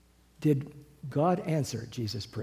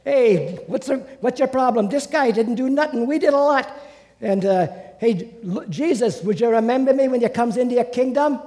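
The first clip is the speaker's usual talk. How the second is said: loud, high Brooklyn wise guy; hammed-up Brooklyn patois, raised voice; Brooklyn accent; 9 semitones higher, 6 dB louder than usual. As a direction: plead the case like a Brooklyn tough guy. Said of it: "I give him Brooklyn patois".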